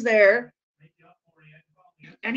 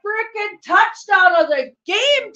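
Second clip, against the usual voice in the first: high, loud triumphant call; excited shout; 10 semitones higher, 8 dB louder than usual. Real, not acted. Fan hailing the third touchdown, thrilled and loud. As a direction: announce it like a delighted fan. Cheering the player.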